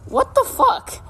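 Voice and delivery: high incredulous outburst; indignant disbelief. Retold or imitated. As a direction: shoot way up in pitch, aghast. Outraged at rival's question.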